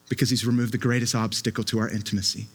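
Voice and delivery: ordinary talk, nothing marked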